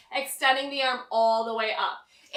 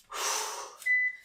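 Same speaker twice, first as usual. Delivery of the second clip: weary sigh; 4 dB quieter than usual. Real, not acted. Tired sigh between sets.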